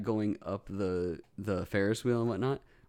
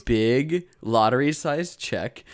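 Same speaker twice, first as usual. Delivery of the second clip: normal talking voice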